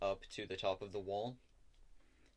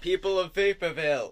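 loud, high-pitched proclamation in character; pompous troop leader; 9 semitones higher, 12 dB louder than usual. Acted. Troop leader hails the townspeople from below the wall, pompous proclamation. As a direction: address the whole city like a grand commander. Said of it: "can you wait until he finishes his monologue".

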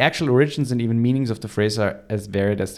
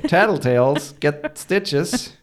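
loud, slow, higher prison saying; mock warning; 6 semitones higher, 3 dB louder, slower than usual. Co-host quotes prison saying, slow mock warning. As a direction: draw out the threat playfully.

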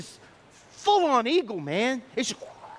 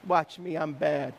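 low, understated swagger; low-pitched deadpan boast; cocky eagle; 9 semitones lower, 4 dB quieter, slower than usual. Retold or imitated. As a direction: voice a cool, self-assured bird. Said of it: "He just goes".

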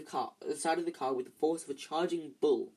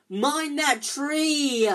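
slow, much higher and louder warning; drawn-out alarmed shout; bellowed; 11 semitones higher, 9 dB louder, much slower than usual. A narrator's reading. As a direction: bellow the warning across the car. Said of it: "Harry bellowed".

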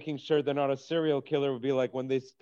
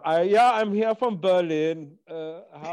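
put-on German accent, higher and louder; mock German accent, raised pitch; foreigner from Berlin; 5 semitones higher, 5 dB louder than usual. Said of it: "I do sometimes do the foreigner".

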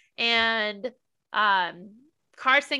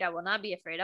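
dropped low and softer, a quiet counter-voice; softer waffling reply; 5 semitones lower, 7 dB quieter, much faster than usual. Retold or imitated. Mocking George's waffling to co-host, lower and softer. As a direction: let it fall away quietly, the other side of the dilemma. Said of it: "Waffling back and forth".

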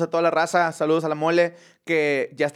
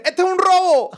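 high, loud hold-up shout; Cuban bank robber; 11 semitones higher, 5 dB louder than usual. Retold or imitated. Cuban announcing a bank robbery, loud. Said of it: "llega un cubano a un banco".

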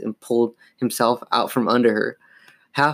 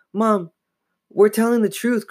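high-pitched pleading protest; insistent; 10 semitones higher than usual. A narrator's reading. Girl insisting to her mother, pleading. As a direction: insist on being believed. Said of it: "Chris insisted".

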